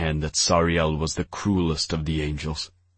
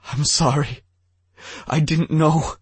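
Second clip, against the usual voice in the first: high, louder, whimpering apology; moaning apology; 10 semitones higher, 4 dB louder than usual. A narrator's reading. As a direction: cry it out in a high wail. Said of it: "King Ebal moaned".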